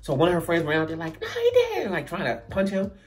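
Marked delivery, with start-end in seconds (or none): 1.2-1.9 very high mock girl voice; outraged friend. Date's friend rushing to punch him, high outraged squeal. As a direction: imitate an indignant friend squealing.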